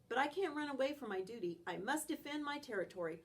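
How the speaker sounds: ordinary delivery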